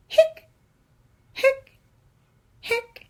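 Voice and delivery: high squeaky hic hic hic; squeaky hiccups. Acted. Skeleton hiccuping to the kids, high squeaky hics.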